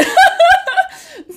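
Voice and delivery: high squealing laugh; high giggle. Real, not acted. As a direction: laugh at yourself. After calling herself mouthy.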